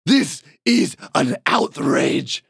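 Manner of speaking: slow then shouted noble outrage; haughty outrage. Acted. Lord Larrington berating the commoner who tried to ride him, shouting. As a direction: play the offended aristocrat, building to a shout.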